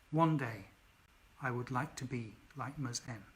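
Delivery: calm, soft reading of the story's closing lines; story narration. A narrator's reading.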